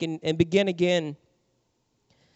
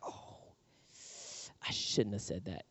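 much softer, lower inner voice; regretful murmur; 9 semitones lower, 15 dB quieter than usual. Retold or imitated. Someone regretting words to their spouse, soft and rueful. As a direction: say it to yourself, caught out.

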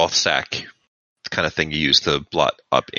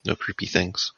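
quiet; 3 semitones higher, 6 dB quieter than usual. Shown as a demonstration. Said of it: "Never mind".

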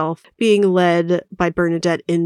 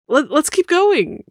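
Sono; 9 semitones higher, slower than usual. Acted. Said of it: "Sono was like".

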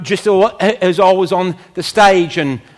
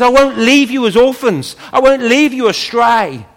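emphatic promise; 7 semitones higher, 5 dB louder than usual. Acted. Preacher voicing God's promise emphatically.

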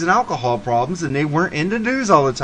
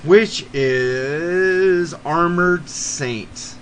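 very slow, drawn-out announcer intro; dramatic band intro; 2 semitones higher, much slower than usual. Acted. Podcast host unveiling his fourth band, drawn-out announcer voice. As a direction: stretch the band name out like a radio announcer.

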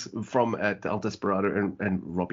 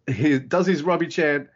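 normal talking voice

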